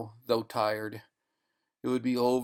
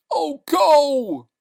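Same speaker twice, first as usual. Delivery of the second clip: anguished cry; 11 dB louder than usual. Acted. Man fighting sedation.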